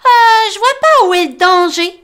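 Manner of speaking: high, loud cheerful mole voice; Tom smiling. Acted. Tom hugged by the rabbit, high smiling voice. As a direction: say it with a big grin. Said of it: "avec un grand sourire".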